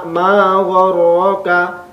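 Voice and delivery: "ma gharraka" with ma lengthened; long ma. Shown as a demonstration. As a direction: draw out "ma" before "gharraka". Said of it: "ma panjang". ("ma" dibaca panjang)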